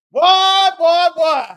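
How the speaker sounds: loud, high holler; amused holler. Real, not acted. Commentator reacting to his own joke, hollering. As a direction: holler it out after a big laugh. Hollering after laughing fit.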